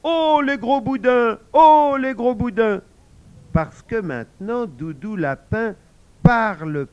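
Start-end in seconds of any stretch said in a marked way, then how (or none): 0.0-2.8 shouted high and loud, playful rabbit; cheerful shout. Acted. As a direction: shout back the teasing, laughing at them. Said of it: "en criant". (crié joyeusement, très aigu et fort)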